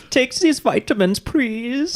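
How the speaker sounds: high, slow cartoon boy voice; Alex Jones rant in a squeaky boy voice; Dutch Boy voice. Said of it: "in Dutch Boy voice".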